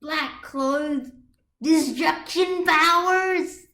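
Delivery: slow sung drunken mockery; drunk sing-song taunt. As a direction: croon the insult like a tipsy jeer. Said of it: "continued flinging insults".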